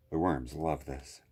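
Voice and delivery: usual speaking voice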